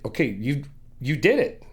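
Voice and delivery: ordinary talk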